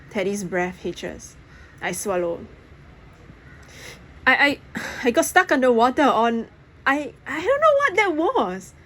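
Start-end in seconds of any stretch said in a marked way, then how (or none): 4.3-8.7 teeth chattering. A narrator's reading. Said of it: "I say, teeth chattering".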